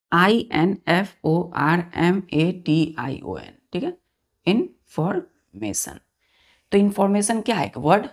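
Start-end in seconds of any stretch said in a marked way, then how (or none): 0.1-3.5 letter by letter, slow and clear; spelled out slowly. Shown as a demonstration. Teacher spelling a word slowly for children. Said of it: "मैंने आपसे बोला". 4.5-6.0 information said slowly, every syllable clear; careful pronunciation. Shown as a demonstration. Teacher modelling information slowly for students. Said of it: "इसको इस पर कैसे pronounce करते हैं".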